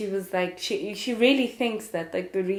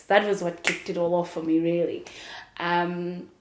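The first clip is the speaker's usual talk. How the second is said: ordinary delivery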